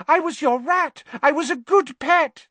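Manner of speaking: very high, loud, desperate squeal; frantic, high-pitched plea; pleading coward. A narrator's reading. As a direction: beg in a high-pitched wail. Pettigrew pleading for his life.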